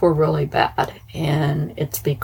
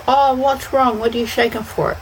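high surprised dentist; 7 semitones higher than usual. Retold or imitated. Voices the dentist's question.

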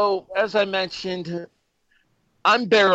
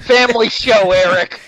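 loud mock scolding; 6 semitones higher, 8 dB louder than usual. Real, not acted. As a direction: call him out, louder. Host scolds cohost jokingly.

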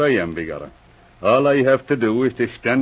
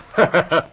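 amused chuckle; 5 semitones higher than usual. Acted. Savoring the Mulligan joke.

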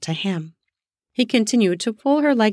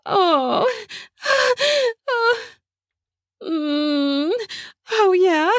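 high pleasure moans; 12 semitones higher, much slower than usual. Acted. Kelly moaning as he circles. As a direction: moan up high, building. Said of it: "She responded by groaning deeply".

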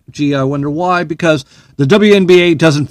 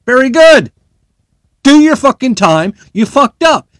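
angry rant; 8 semitones higher, 6 dB louder than usual. Real, not acted. Ranting at jailed player.

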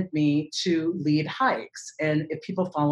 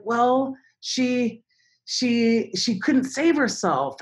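higher, halting mock kid reply; imagined sad kids; 6 semitones higher, much slower than usual. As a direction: lightly act a child hesitantly breaking bad news. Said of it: "I imagine them sad".

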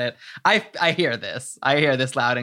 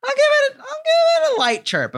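very high, squeaky mock chirp; playful chirp; 4 dB louder than usual. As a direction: pitch it way up, like a little bird chirping. Playfully giving a chirp rating.

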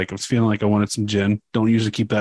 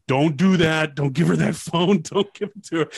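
retold urgent plea; 5 semitones higher than usual. Retold plea not to hand over phone.

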